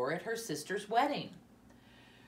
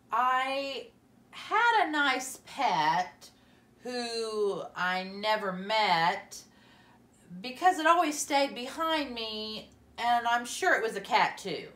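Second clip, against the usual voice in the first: slow, higher, louder recital; recited slowly; 5 semitones higher, 5 dB louder than usual. Acted. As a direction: read each word very slowly to the class. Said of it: "recited slowly".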